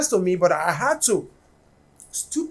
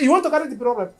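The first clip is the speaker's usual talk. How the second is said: louder, taunting Pidgin challenge; a louder Pidgin taunt at the driver; mock angry taunt; 4 semitones higher, 7 dB louder, much faster than usual. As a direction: throw the challenge at him, louder and louder.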